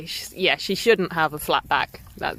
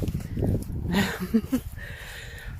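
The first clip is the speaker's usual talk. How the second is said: breathy laugh; 2 semitones higher, 5 dB quieter than usual. Real, not acted. Laughing at her running motive.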